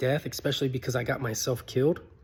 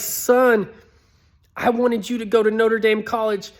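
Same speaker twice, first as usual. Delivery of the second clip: urgent plea; 7 semitones higher, 4 dB louder than usual. Retold or imitated. Ghost urging his son on.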